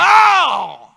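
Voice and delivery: high, loud shrieked no; a demon crying out in protest; demon's scream. Retold or imitated. Demon in the woman refusing loudly, shrieked no. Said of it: "Bota aí no ouvido do demônio".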